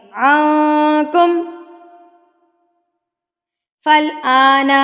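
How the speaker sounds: slow melodic recitation with a heavy kha; Quran recitation. Shown as a demonstration. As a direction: chant the verse slowly. Recites verse.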